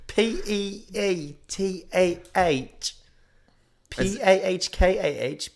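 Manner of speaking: high, slow British spelling-out; mock British accent. Co-host spelling out the meme, mock British accent.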